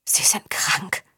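slow, voiceless whisper of shock; shaken whisper. A narrator's reading. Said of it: "wisperte Violante erschüttert". (erschüttertes Wispern)

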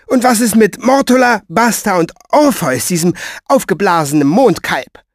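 high, forceful, breathless-seeming torrent; indignant, unstoppable tirade; bossy woman holding forth. Acted.

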